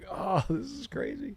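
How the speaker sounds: mock astonished discovery, drawn out high; amazed realization. Telling friends how he discovered the word's meaning: high amazed marvel. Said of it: "And I was like".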